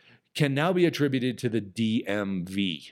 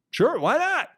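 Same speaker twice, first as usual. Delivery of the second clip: very high, louder delighted agreement; cheerful eager yes; 5 dB louder than usual. Retold or imitated. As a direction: squeal it out like you can't wait. Happily agreeing to be contacted.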